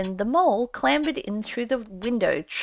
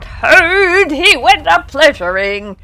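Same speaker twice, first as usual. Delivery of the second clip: loud, higher vengeful shout; furious yell; 6 semitones higher, 11 dB louder, slower than usual. A narrator's reading. Rat charging the Chief Weasel, loud yell. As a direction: roar it as you charge. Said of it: "he yelled".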